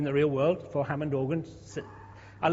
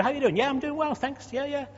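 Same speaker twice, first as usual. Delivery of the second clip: breezy neighbour chat; 9 semitones higher than usual. Retold or imitated. Mimics superficial neighbour small talk.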